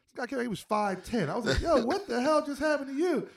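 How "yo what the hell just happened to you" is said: shocked to see how tall he got. Retold or imitated. Retelling to friends, a shocked high yell at his grown friend.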